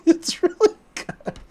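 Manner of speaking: high squealing laugh through the words. Real, not acted. Host gushing over the episode, laughing high. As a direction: giggle out the praise.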